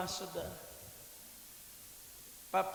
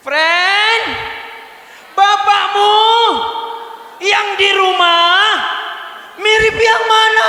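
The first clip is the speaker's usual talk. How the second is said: high, loud teasing shout with a slow middle; 27 dB louder, slower than usual. Shown as a demonstration. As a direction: holler it at your neighbour.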